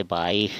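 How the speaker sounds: "बाईस" as "baikh"; final s as kh. Shown as a demonstration. Teacher showing the Assamese rule, "baikh" for बाईस. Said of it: "स का उच्चारण हमेशा ख".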